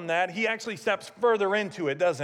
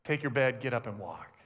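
soft command; 9 semitones lower, 6 dB quieter than usual. Retold or imitated. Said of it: "Jesus looks at him and goes".

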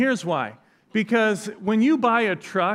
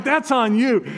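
mock angry threat; 6 semitones higher, 4 dB louder than usual. Mock threat to prankster friend.

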